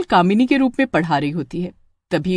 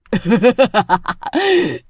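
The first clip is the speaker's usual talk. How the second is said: witch cackle; 2 semitones higher than usual. Acted. Witch gloats over Pappu, evil cackle. Witch reveals true form.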